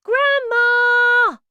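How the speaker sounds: high, loud calling shout. A narrator's reading. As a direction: call out to her at full volume. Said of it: "so I shouted as loudly as I could".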